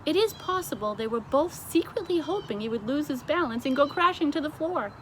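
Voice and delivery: ordinary delivery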